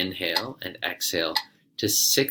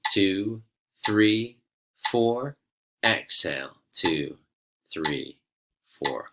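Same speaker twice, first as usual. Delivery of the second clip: calm, steady counting of breaths; guided breath count; slower than usual. Acted. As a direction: count the class through each breath. Counts the breathing ratio aloud.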